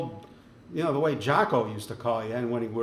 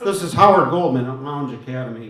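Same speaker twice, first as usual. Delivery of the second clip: raised voice, pushing the name through; loud phone introduction; 9 dB louder than usual. Real, not acted. Host re-introduces himself to a critic who can't hear, loudly. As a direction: project down a bad line.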